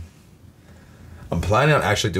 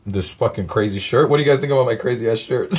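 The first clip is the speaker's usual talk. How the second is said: normal talking voice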